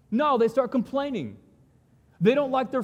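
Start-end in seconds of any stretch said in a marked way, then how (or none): none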